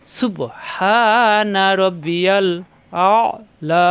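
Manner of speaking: very slow, drawn-out Arabic recitation. Shown as a demonstration. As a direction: stretch each sound of সুবহানা, pressed হা. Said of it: "কণ্ঠনালীতে চাপ দিয়ে". (ধীরে টেনে তেলাওয়াত)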